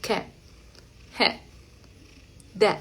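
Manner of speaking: "Cat, head, dead" short and quiet, final stops unreleased; super soft held ending. Shown as a demonstration. Teacher recapping for learners, very soft held T. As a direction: clip the ends of "Cat, head, dead" with no release. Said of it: "esa T súper suavecita".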